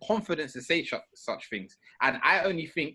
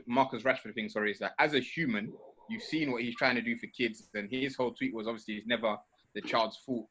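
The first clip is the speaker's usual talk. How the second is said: ordinary talk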